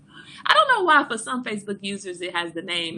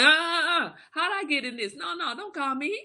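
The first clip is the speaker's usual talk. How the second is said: lightly voiced, higher panicky stammer, half laughing; flustered friend; 9 semitones higher than usual. Friend Shay refusing to be called in, mild mock panic. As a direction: play it as someone dodging the job, a hint of panic. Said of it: "Shay like".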